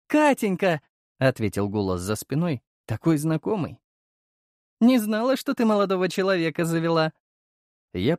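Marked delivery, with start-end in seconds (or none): 0.1-0.8 радостный оклик. Acted. Пожилая аристократка зовёт радостно. 4.8-7.1 игриво подтрунивая. Acted. Аристократка подтрунивает над Катей.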